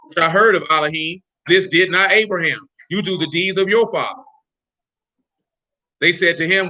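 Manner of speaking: low, steady scripture recitation; scripture reading. Acted. Teacher reading John's gospel to the group, scripture reading. Reads scripture aloud to listeners.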